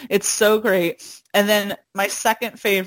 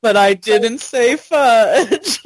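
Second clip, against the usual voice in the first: slow, louder, laughing quote; drawn-out punchline; 2 semitones higher, 6 dB louder, slower than usual. Retold or imitated. Friend finishing a favorite movie quote, drawn out and laughing. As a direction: stretch the punchline out loudly through a laugh.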